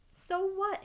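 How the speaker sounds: high, loud defiant outburst; shouted. A narrator's reading. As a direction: throw the objection back at them. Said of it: "Harry shouted".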